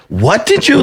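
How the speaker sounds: high, disbelieving mock retort; incredulous what. Comic telling the gum flicker off, incredulous. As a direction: bark back in disbelief at the guy.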